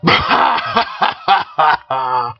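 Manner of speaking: coarse cockney cackle; loud, crude guffaw; rude coarse laugh. Acted. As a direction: laugh rudely at the boy. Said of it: "The rudest, coarsest laughter".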